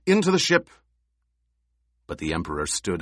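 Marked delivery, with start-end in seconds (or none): 0.1-0.7 high, loud urgent shout. Acted. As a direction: yell the order to flee. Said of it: "officer shouted".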